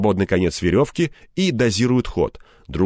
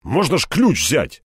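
higher, mildly indignant protest; 6 semitones higher than usual. A narrator's reading. Степаныч objects to forcing the lock, indignant. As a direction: object with a touch of outrage. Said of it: "возмутился Степаныч".

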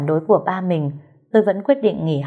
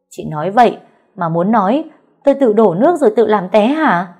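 loud, high furious shout; mother-in-law shouting at her daughter-in-law; shouting louder; 3 semitones higher, 6 dB louder than usual. Acted. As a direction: yell the accusation. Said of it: "bà hét lớn hơn".

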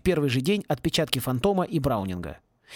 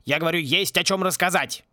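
raised voice. A narrator's reading. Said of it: "повысил голос завхоз".

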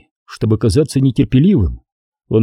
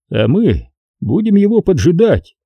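higher-pitched, lightly mocking reply; understated sneer, pitched up; 7 semitones higher than usual. A narrator's reading. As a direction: read it with a touch of mockery. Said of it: "с насмешкой ответил Куайгон".

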